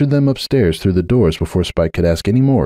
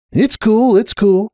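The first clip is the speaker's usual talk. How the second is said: light, high and breezy, brushing it off; airy Spike voice; slower than usual. A narrator's reading. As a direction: toss it off casually, a young dragon's voice. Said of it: "with an airy shrug".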